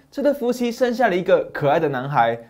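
ordinary delivery, nothing marked

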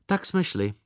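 plain reading voice

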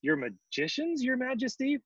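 mildly fearful question, high at the end; shivering worry. A narrator's reading. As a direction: question the king with a touch of dread. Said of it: "Bartholomew shivered".

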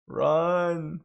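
excited. Real, not acted. Panicked retreat.

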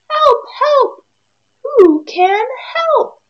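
high, loud squawking plea; duck crying for help. Acted. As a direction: shriek for help like a trapped duck.

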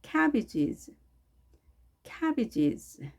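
"cabbages" with a clear "a" as in cat; careful model of "cabbages", first vowel exact; 정확한 '애' 발음. Shown as a demonstration. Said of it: "에 발음 정확하게 하시고요". ("cabbages"의 '애'를 정확하게)

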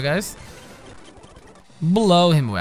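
ordinary talk

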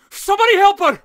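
high, loud cry for help; loud desperate call; urgent shout. Acted. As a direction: shout for someone to help her.

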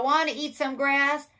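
high, loud, animated invitation; storybook cow. A narrator's reading. Cow inviting the spider to eat grass, high and loud. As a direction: pitch it up brightly as the cow. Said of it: "said the cow".

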